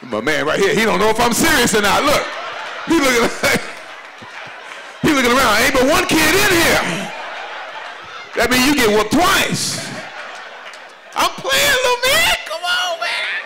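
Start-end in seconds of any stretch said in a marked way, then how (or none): none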